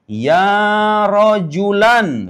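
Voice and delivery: very slow Ya rojulan, stretched; drawn-out Arabic call. Shown as a demonstration. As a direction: hold the final an of rojulan. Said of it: "Nggak dimaksudkan kan".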